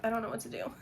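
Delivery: normal talking voice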